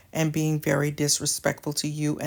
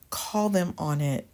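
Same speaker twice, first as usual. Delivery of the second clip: ordinary talk, nothing marked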